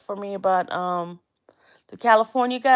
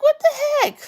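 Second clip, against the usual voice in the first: high squeaky disbelief, pitched way up; shocked; 5 dB louder than usual. Retold or imitated. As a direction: throw up your voice in stunned disbelief. Shocked reaction to picture.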